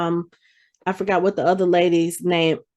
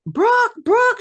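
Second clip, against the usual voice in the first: a higher, mock call for help across the deck. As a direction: call out Brooke's name urgently but lightly. [name] calling for Brooke.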